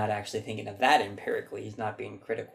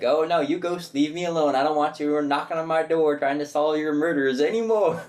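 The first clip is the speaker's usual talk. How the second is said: louder, higher hammed-up exasperated psychic; Whoopi Goldberg in Ghost; 5 semitones higher, 7 dB louder than usual. Imitating the lady as a psychic tired of ghosts, hammed up. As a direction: shoo the ghosts away, hammed up. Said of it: "she's Whoopi Goldberg in Ghost".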